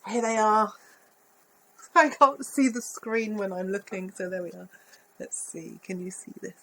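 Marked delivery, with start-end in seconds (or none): none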